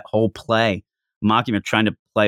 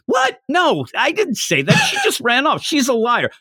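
high, shouting indignant denial; mock outraged protest; 12 semitones higher than usual. Denji denying it to Makima, high and outraged. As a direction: squeal it like you're wrongly accused.

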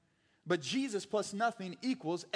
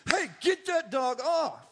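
loud, higher, slow order; firm command; 7 semitones higher, 6 dB louder than usual. Shown as a demonstration. The right response to a jumping dog, loud and firm. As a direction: stop the dog right now. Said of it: "it should be".

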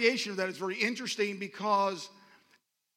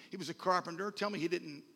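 normal talking voice

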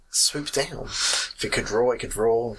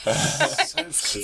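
cooing. Real, not acted. Cooing at the otter.

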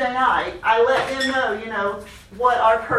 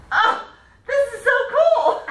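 excited; 14 semitones higher, 3 dB louder than usual. Retold or imitated. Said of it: "whole congregation goes".

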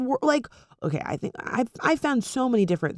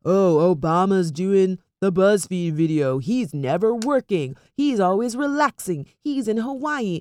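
sarcastic complaint; 4 dB louder than usual. Retold or imitated. Imitating Facebook commenters attacking Obama, sarcastic complaint. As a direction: imitate an online griper, stretched out and sing-song. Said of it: "say it in a sarcastic".

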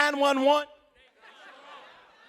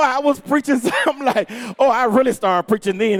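normal talking voice

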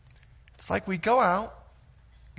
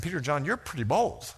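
ordinary delivery

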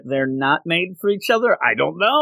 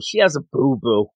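usual speaking voice

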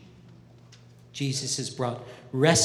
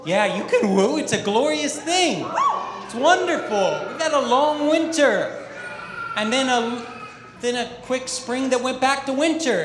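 higher and louder, riding the crowd's cheer; excited about spring; 8 semitones higher, 6 dB louder than usual. Real, not acted. Pastor cheering with the congregation about spring, excited. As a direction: lift it up like the room just cheered.

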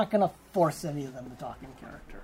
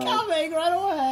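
put-on cracking teen voice; 9 semitones higher than usual. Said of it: "imitating Lewis".

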